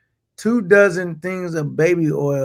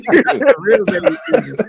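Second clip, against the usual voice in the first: high, laughing repeat; laughing echo; 12 semitones higher than usual. Real, not acted. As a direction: say it through a laugh.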